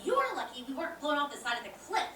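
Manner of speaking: ordinary talk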